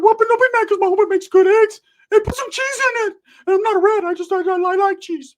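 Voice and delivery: very high, loud cartoonish squeal of a voice; Ricky. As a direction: put on an excitable high-pitched cousin voice. Voicing his cousin Ricky.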